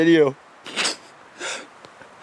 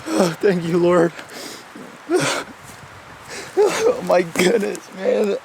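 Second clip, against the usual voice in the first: overcome, raised breathy crying voice; real tearful relief; 4 semitones higher, slower than usual. Real, not acted. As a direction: gasp it out through happy tears. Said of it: "I'm sorry for crying".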